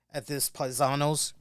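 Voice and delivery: normal talking voice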